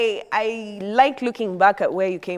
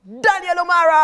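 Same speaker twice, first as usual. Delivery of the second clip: loud, high showman call; hype announcement; 10 semitones higher, 7 dB louder than usual. Real, not acted. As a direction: announce the guest like a game show host. Host announces guest into studio.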